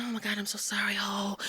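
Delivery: very soft, half-whispered mock sympathy; imitating hushed, gushing condolences. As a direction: murmur it like a mourner offering sympathy. Mimicking condolences of people rallying round.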